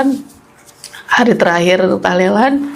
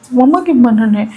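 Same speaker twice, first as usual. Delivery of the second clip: higher, anxious question; mildly panicked plea; retold panic; 4 semitones higher than usual. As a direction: act out a worried child asking grandmother. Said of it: "Panik tuh, Bang".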